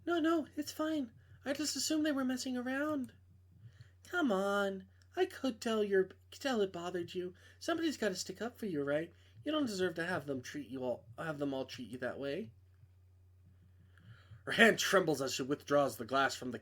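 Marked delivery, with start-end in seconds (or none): 0.0-3.1 Maeve, lightly voiced, a touch higher; a hint of a nervous young woman; girl voice. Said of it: "I can't do girl voices". 4.1-12.5 lightly raised voice for Camila, a friend calling; a hint of a young woman on the phone; girl voice. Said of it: "I can't do girl voices".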